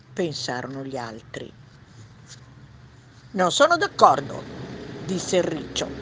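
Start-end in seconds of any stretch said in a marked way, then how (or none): 3.3-4.4 lightly voiced hedgehog, higher and louder. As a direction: object as a hedgehog, a bit high and loud. Hedgehog voice in read story. (riccio, voce un po' acuta e forte)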